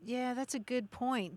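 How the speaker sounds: ordinary delivery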